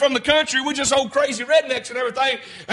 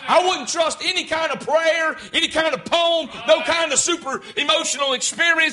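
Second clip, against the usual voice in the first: higher, ringing preacher's cry; country preacher warning of hell, ringing and raised; full-force preaching; 4 semitones higher than usual. Acted. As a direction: preach it hard at the congregation.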